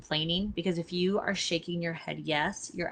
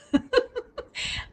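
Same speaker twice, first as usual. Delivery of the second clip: a squeaky laugh, much higher; high burst of laughter; 5 dB louder than usual. Real, not acted. Mother laughing at her kids' whining, high and bright.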